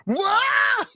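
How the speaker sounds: high shouted what through laughter; high laughing shout of disbelief; shocked shout. Real, not acted. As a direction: squeal it in disbelief. Laughing at imagined skit.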